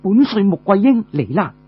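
high, ringing call of a commander; commanding shout. Acted. Marshal challenges the enemy general in a high ringing shout. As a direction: announce yourself like a marshal. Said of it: "大喝一聲".

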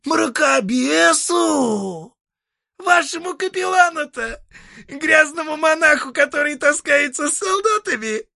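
high, creaky devil voice; a creaky, mocking old devil.

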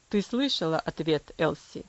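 low, cold father's voice; cool and distant, pitched low; coldly. Acted. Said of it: "холодно произнёс отец". (холодно, низким голосом отца)

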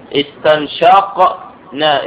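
استنشقنا spelled out very slowly, the قاف held silent before نا; drawn out so each syllable of استنشقنا is heard; slow syllable demo. Shown as a demonstration. Teacher showing students the silent letter, very slow. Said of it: "القاف ساكنة".

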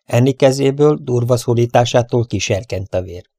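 ordinary delivery, nothing marked

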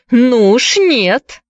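high, slow, drawn-out refusal; defiant. A narrator's reading. Priscilla grabs the departing man, defiant. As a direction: refuse point-blank, stretching the words. Priscilla stops Gibbs from leaving.

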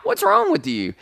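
ordinary delivery

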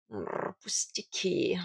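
slow purring cat voice; magnanimous cat. A narrator's reading. Cat brushing off his mistress's thanks, magnanimous. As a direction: wave thanks away in a drawn-out purr. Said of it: "великодушно тряхнул ушами кот".

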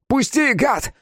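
very high enraged shriek; angry squealing. A narrator's reading. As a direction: squeal in fury to be let go. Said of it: "завизжал, но не от боли, а от гнева".

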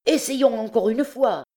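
high, urging exclamation; eager high-pitched call; rallying cry. A narrator's reading. Beggar urges another attack.

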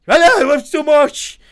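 loud, high, slow bellow; urging shout. A narrator's reading. Osip yelling at the men to run. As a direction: drive them on with a big yell.

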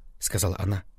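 plain reading voice

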